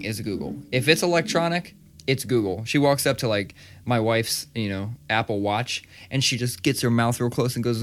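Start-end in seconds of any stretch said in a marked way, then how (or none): none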